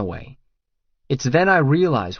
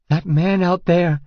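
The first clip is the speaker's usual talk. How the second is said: high, louder panicked cry; frightened; 7 semitones higher, 5 dB louder than usual. A narrator's reading. Naked Regan points at the intruder, terrified cry. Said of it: "Her body is shaking with fear".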